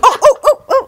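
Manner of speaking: high, loud flustered cartoon cries; cartoon yelp. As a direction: squeak like a startled cartoon Dalmatians character. Cartoon movie character flustered.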